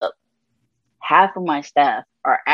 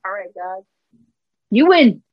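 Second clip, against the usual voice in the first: exasperated surrender; 3 semitones higher than usual. Retold or imitated. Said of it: "And I'm like".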